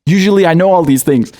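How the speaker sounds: ordinary talk, nothing marked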